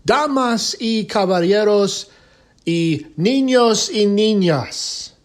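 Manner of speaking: lucha libre announcer. Acted. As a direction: announce it to the arena in Spanish. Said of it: "or should I say".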